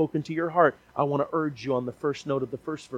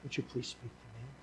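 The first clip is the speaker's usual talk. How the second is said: soft, humble plea to God; a soft imagined prayer from a listener; hushed prayer; 10 dB quieter than usual. Pretend. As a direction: pray it under your breath, much softer. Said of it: "or you'll say".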